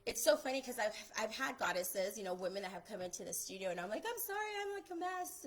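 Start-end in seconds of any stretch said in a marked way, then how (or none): 4.1-5.4 high-pitched mock-embarrassed apology; sheepish apology. Photographer replaying her apology to studio clients, sheepish and high. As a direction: squeak out a sheepish sorry in a much higher voice.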